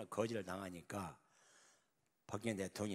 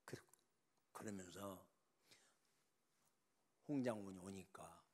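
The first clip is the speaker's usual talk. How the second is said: normal talking voice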